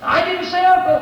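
high, proud mock triumph; mock brag. Boy with broken arm, bragging in mock triumph. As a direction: crow about not giving in. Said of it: "he twists it and he breaks it".

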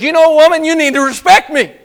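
hammed-up demand for respect from the wife; playful mock husband; mock bossy husband. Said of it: "and the husband says".

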